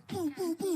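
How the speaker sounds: retching noises. Retold or imitated. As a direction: imitate someone heaving in a bathroom. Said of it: "and you just hear".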